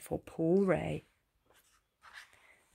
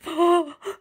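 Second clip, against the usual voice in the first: frightened gasp; 8 semitones higher, 3 dB louder than usual. Acted. Ray scared for his life.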